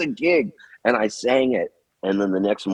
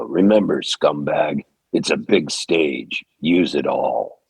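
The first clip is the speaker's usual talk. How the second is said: low, slow, gruff mentor advice; 6 semitones lower, slower than usual. Retold or imitated. Mentor with his arm around him giving stage advice, deep and slow. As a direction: lower and take your time, like a big imposing drummer. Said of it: "had a deep voice".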